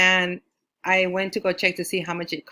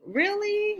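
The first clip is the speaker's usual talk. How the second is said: very high squeak of surprise; amazed. Retold or imitated. Finding the strangers are neighbors, high amazed squeak. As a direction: leap way up in delighted disbelief. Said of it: "And I was like".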